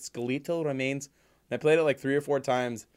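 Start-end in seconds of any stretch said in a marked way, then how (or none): 0.0-1.1 "Skeletal" slowed, stressed as skuh-LEE-tul; odd skeletal pronunciation. Retold or imitated. Replaying the girl's line for the co-host, "Skeletal" as skuh-LEE-tul. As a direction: say "Skeletal" higher, leaning on LEE. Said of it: "went back and listened to it".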